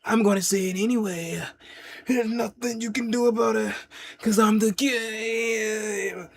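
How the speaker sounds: deliberate mock wrestler taunt; the Game's promo voice. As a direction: stretch each phrase like a wrestling promo. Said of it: "If he'd be like".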